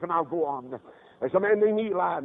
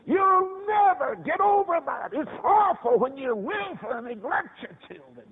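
high, slow preacher's wail; impassioned warning; 9 semitones higher, 3 dB louder, slower than usual. Real, not acted. Preacher warning parents about neglecting children, high impassioned cry. Repeats warning at full force.

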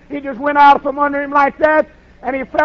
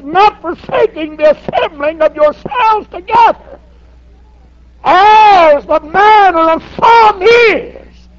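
slow, high, shouted scripture; preaching at full force; 6 semitones higher, 6 dB louder, slower than usual. Acted. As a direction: thunder the verse out to the church. Preaching the verse.

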